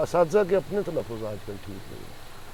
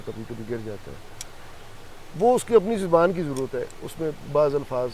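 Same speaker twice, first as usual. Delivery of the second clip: ordinary talk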